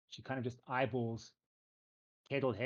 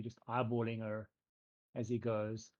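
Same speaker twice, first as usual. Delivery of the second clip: normal talking voice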